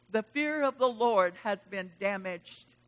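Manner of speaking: normal talking voice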